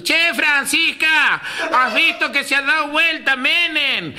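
high, drawn-out call to the back yard; acted old aunt's long, high-pitched shout; yelling across. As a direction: holler slowly to an aunt out back. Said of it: "le pega el grito a la otra".

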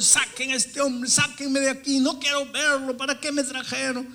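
high, loud frantic shouting; acted yelling. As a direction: shout in protest on one high pitch. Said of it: "él comenzó a gritar". (gritos agudos de protesta)

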